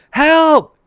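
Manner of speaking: high, loud mock yell of "Help!"; hammed-up yell for help; screaming for help. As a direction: call out for help across the water. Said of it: "I start screaming".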